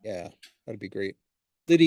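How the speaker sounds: ordinary talk, nothing marked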